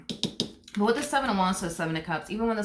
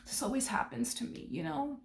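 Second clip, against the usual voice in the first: higher and softer, a sulky whine; softer, higher mock whimper; mock self-pity; 6 semitones higher, 8 dB quieter than usual. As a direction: whimper it like the put-upon victim. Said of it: "This person might go as far as to s".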